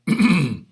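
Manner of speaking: short, loud throat clear; real cough-like clearing of the throat. Real, not acted. Presenter troubled by his throat mid-explanation, loud throat clearing. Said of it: "My apologies for my throat".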